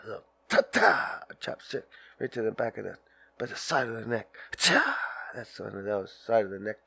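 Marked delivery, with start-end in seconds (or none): 0.7-1.2 high sharp "ta" chop sound; mock martial-arts chop cry; karate chop yelp. Demonstrating karate chops. 4.6-5.0 high sharp "Ta!" chop sound; hammed-up chop shout; karate chop yelp. Demonstrating neck chop.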